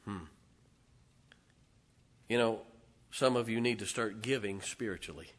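normal talking voice

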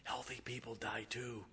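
very soft, secretive punchline; hushed, sly confiding; whisper. Retold or imitated. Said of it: "I was whispering".

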